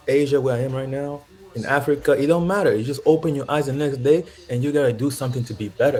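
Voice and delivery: ordinary delivery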